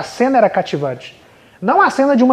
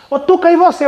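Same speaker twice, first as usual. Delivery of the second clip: a lightly voiced teacher, pitched up; teacher calling on a pupil in class, pitched up; higher teacher voice; 9 semitones higher, 4 dB louder than usual. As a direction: call on the child like a cheerful teacher.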